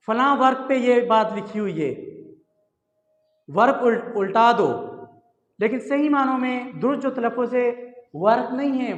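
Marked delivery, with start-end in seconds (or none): none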